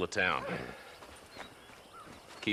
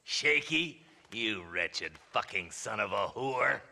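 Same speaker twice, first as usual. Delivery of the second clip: normal talking voice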